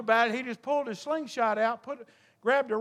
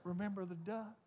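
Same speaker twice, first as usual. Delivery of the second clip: hushed, much softer little sister threat; mock whisper; 4 semitones lower, 15 dB quieter than usual. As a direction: drop to a sly hush. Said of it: "And she whispered".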